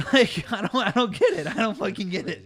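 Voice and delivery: high-pitched, incredulous laughing protest; exasperated laughing. Real, not acted. Barista baffled by customer, exasperated laughing. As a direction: laugh helplessly through real exasperation.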